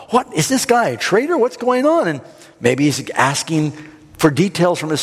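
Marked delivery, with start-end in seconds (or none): 0.1-2.1 high-pitched, indignant questioning; huffing king. Acted. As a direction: act out a flustered king demanding answers. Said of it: "he's huffing around going".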